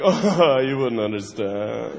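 low, fading, dismissive; condescending banker. Acted. Bankers dismissing the public, low and condescending. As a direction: brush them off softly from above. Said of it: "they're like".

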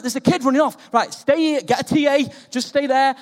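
loud retold instructions across a field; teacher shouting across a pitch; calling out to staff.

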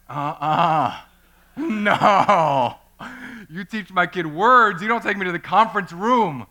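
loud, indignant retort with a laugh; angry refusal. Retold or imitated. Father refusing to be led to the conference room, loud and indignant.

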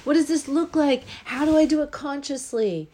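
excited bewildered. Retold or imitated. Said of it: "But then the question was".

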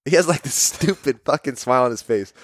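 ordinary talk, nothing marked

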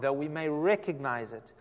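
ordinary talk, nothing marked

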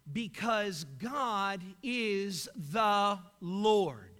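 slow emphatic statement. Acted.

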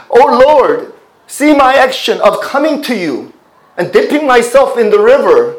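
high, loud cry of a repentant sinner; crying out to the Lord. Retold or imitated. Preacher voicing a repentant sinner crying out to God, loud plea. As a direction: call out to God as a sinner pleading for forgiveness. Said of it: "They're crying out when they're saying".

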